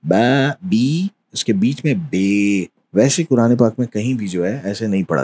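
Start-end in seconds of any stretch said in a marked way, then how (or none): 0.0-2.7 बा, बी, then बे between them; vowel contrast ba bi be; imala vowels. Shown as a demonstration. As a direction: slide from बा and बी to बे. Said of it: "उसको कहीं बीच में पढ़ रहे हैं".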